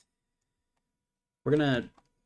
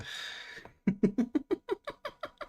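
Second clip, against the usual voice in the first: high-pitched burst of laughter; laughing. Real, not acted. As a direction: let out a high, tapering laugh. Reacting to trouble with code.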